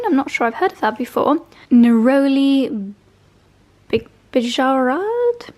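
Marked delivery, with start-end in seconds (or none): 1.7-5.5 slow, careful attempt at a French accent on "Neroli Bijarad". Shown as a demonstration. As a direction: sound the name out slowly in a hesitant French accent. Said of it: "trying to say it nicely with a French accent".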